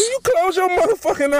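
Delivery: high, indignant yell; angry outburst. Acted. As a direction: bark it back outraged.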